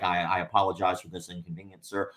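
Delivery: polite manager voice. Retold or imitated. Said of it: "I have to say something like".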